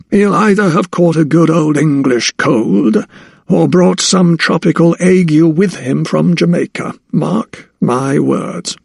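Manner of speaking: put-on high old anatomist, slowing on the last words; old anatomist, disapproving.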